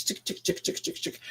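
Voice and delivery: voiceless tick tick sounds; whispered ticking. Retold or imitated. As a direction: click it under your breath. Imitating card flipping.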